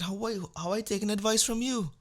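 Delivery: high, odd voice of disbelief; high mock outrage; incredulous mock. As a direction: scoff at the dentist in a squeaky put-on voice. Mocking dentist's bad teeth.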